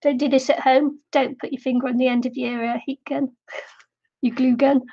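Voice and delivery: ordinary delivery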